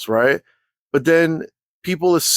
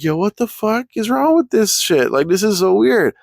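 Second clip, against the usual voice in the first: high, exasperated outburst; incredulous protest; 8 semitones higher than usual. Retold or imitated. Speaker objecting to the chat flirting, incredulous high protest. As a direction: protest in disbelief, pitch pushed up.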